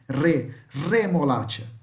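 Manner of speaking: "remolacha" opened with a rolled R; slow model of "remolacha" with vibrating R. Shown as a demonstration. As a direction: trill "re", then "remolacha" the same way. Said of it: "Igual minha voz aqui".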